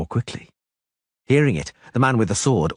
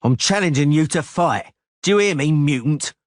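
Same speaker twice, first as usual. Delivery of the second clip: raised, insistent challenge; shouting; 7 semitones higher than usual. Acted. Cicada barring Geralt's way, insistent raised shout. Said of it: "Cicada shouted".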